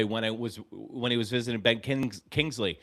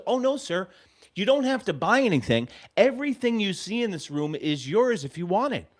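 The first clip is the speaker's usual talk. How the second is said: eager hostess; 7 semitones higher than usual. Retold or imitated. Voices the YouTube lady.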